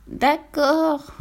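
high, reluctant piglet sigh. A narrator's reading. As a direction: give in with a small high sigh. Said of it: "soupira le porcelet". (soupir résigné de porcelet)

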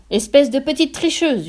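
a father exploding at his daughter; sudden angry yell. A narrator's reading. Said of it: "hurla brusquement le père".

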